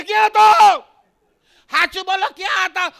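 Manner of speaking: high screeching mock-wife shout; loud, high-pitched accusing yell; wife's fiery tone. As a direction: erupt like lava, hammed-up. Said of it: "એનો tone જ એટલો ખરાબ".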